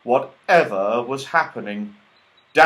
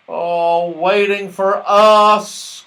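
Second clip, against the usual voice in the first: slow, drawn-out groan; 8 semitones higher, 9 dB louder, much slower than usual. A narrator's reading. Moonface to friends at the fishing rods, groaning. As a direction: sag into it, fed up. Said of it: "groaned Moonface".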